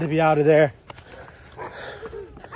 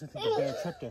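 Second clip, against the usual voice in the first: laughing; 11 dB quieter than usual. Real, not acted. Laughs while telling.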